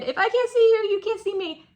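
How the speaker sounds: put-on high, loud little-kid voice. Keeper voicing the scared spider as a child, high and loud. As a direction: voice a child hiding from the grown-ups. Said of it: "Like a kid".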